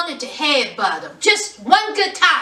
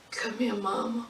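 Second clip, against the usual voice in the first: low, very soft coaxing voice; mock tender murmur; 7 semitones lower, 14 dB quieter than usual. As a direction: imitate a sweet-talking husband, hushed. Imitating Michael soothing his wife.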